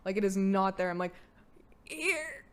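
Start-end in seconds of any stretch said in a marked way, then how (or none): 1.8-2.4 squeaky, cracking "Here." at a higher pitch, breaking into a laugh; a strained high "Here." that gives out; lost voice. Shown as a demonstration. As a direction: show how "Here." came out with no voice left. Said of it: "my voice is gone".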